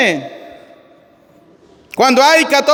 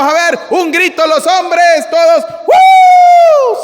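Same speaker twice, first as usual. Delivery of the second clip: high, loud call to the men, drawn out; rally a party crowd into a long, high "uh!"; party whoop; 7 semitones higher, 4 dB louder, much slower than usual. Acted. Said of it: "si estuviéramos en una fiesta y dijéramos".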